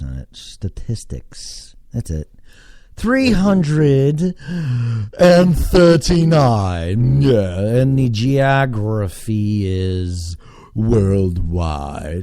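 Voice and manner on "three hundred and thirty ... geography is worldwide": slow, drawn-out announcer voice; hammed-up, stretched-out proclamation; grand announcer. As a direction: announce the stats like a big reveal. Grandly announces his own statistics.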